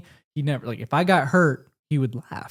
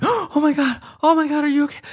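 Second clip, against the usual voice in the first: much higher alarmed mother; panicked mom; 13 semitones higher, 3 dB louder than usual. Retold or imitated. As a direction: gasp it like a frightened mom. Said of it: "she's like".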